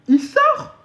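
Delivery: high squealing laugh through the words. Shown as a demonstration. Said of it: "mon anglais, il sort". (aigu en riant)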